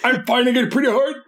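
talking while fighting a sneeze; holding back a sneeze. Real, not acted. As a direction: hold in a sneeze as you speak. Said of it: "I'm trying not to sneeze on podcast".

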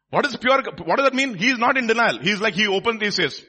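high, loud emphatic explanation; forceful preaching. Real, not acted. Preacher explaining the pure in heart, forceful. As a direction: raise the voice to hammer it home.

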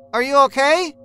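calling through a door. A narrator's reading. Laura checking on the man inside, calling out. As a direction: call out to check on someone.

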